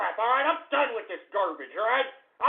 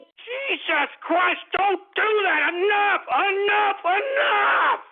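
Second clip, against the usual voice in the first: exasperated shouting; 9 semitones higher, slower than usual. Real, not acted. Host begs a caller to stop singing, exasperated high shout. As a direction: shout it down, drawing out each ENOUGH. Host cuts off a sung prank clip.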